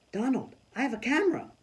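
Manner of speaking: high, eager realisation; a girl remembering she has a camera; excited. Acted. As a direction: blurt it out with new hope.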